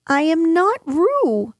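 high-pitched protest; insistent Piglet. A narrator's reading. Piglet tells Kanga who he is, insistent. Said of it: "said Piglet loudly".

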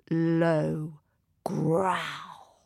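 low, drawn-out storytelling menace; low, ominous. A narrator's reading. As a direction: draw out the deep words slowly. Narrator drawing out a growl description.

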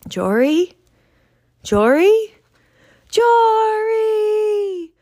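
rising calls growing into a loud high cry; searching for the lost baby, calling his name. Acted. Children calling for lost Jory.

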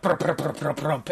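rapid rolling prump-prump of little paws; scampering sound effect. Retold or imitated. Game master imitates Dayu scampering to the table, pattering noise. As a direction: imitate a patter on all fours.

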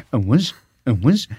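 a quick lazy buzzing whiz. Retold or imitated. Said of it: "[name] putting on his shoes".

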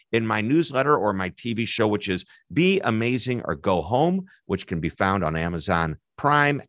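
ordinary delivery

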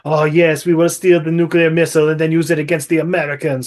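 Russian movie villain plotting; cartoon Russian schemer; mock Russian accent. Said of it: "the Russians hear about it, and of course they're like".

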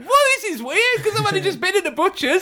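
high amazed retelling. Retold or imitated. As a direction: marvel at the coincidence. Quoting own amazed remark.

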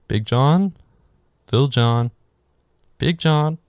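names called with a lilt; mock calling out. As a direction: call out as if hunting through the house. Voicing couple calling each other.

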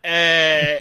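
loud, drawn-out "ehh" of recognition; acted greeting cheer. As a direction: cheer loudly as if spotting a kindred spirit. Acts out instant bonding greeting.

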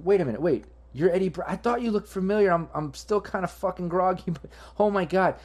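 high, excited gushing fan voice; breathless star-struck squeal; starstruck fan. Acted. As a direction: gush like a dazed fan recognizing a star.

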